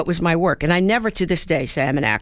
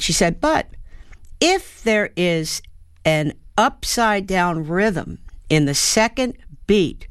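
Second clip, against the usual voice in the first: normal talking voice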